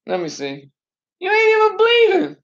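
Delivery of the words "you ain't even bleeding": teasing dad. Real, not acted. Dad checks daughter's scrape.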